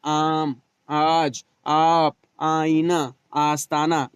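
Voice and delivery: आम, आज, आप, आईना, आस्ताना with the opening आ stretched long; drawn-out alif. Shown as a demonstration. Teacher drawing out the long alif for students to repeat. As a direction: stretch the आ in each word like a teacher. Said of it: "अलिफ ममदूदा को खींच कर पढ़ा जाता है".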